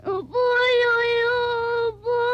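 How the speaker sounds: tearful, obedient reply; child answering through tears; crying. Acted. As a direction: sob out a promise to grandfather. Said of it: "Walang dilaramang umiiyak".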